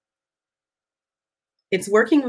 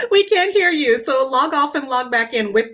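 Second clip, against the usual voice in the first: laughing; 5 semitones higher than usual. Real, not acted. Laughing at mic troubles.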